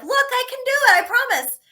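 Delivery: high-pitched eager pleading; eager plea. Retold or imitated. Singer begging other bands for a chance, high eager plea. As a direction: plead in a high, hopeful little voice.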